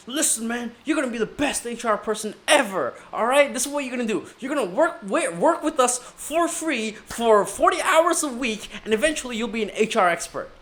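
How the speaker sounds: pushy recruiter pitch. Retold or imitated. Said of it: "they were like".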